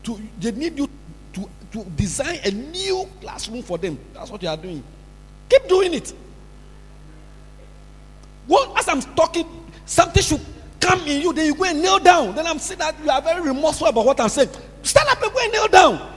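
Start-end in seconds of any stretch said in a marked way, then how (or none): 5.5-16.1 raised, higher voice ending in a shouted order; stern scolding. Real, not acted. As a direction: tell off someone caught not listening. Preacher scolds an inattentive child.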